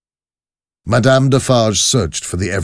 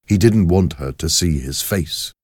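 plain reading voice

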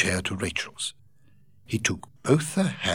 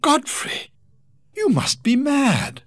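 much higher, breathy startled exclamation; shocked Rachel; 5 dB louder than usual. Acted. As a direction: gasp at his proposal. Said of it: "Rachel exclaimed".

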